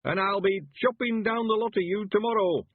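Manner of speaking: put-on high, loud old man's crow of victory; loud, high triumphant old villager; triumphant shout. A narrator's reading. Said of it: "he shouted triumphantly".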